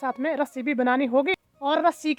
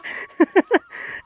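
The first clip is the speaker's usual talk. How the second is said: relieved breathy laugh; 4 semitones higher than usual. Real, not acted. Relieved laugh after scare.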